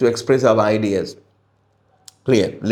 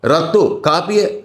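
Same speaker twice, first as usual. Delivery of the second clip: higher, brisk calling to an animal; raised voice; 6 semitones higher than usual. Shown as a demonstration. Teacher showing how Syria does not talk to animals, raised voice. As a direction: call it out sharply, pitched up. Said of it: "not like that".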